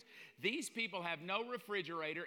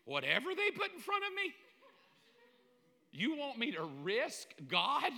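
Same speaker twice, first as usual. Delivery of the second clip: squeaky high disgusted whine, slowing at the end; hammed-up grossed-out protest; mock disgust; 9 semitones higher, 6 dB louder than usual. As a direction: recoil in mock horror at the food. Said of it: "I'm like".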